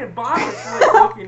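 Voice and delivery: ordinary delivery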